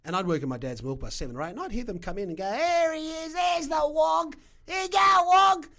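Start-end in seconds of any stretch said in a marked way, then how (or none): 2.5-5.7 loud, high drawn-out holler; mock a heckler yelling across the shop; rowdy pub customer. Pub drinkers taunting the migrant milk bar owner, rowdy mocking shout.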